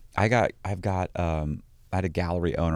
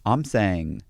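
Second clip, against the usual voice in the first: usual speaking voice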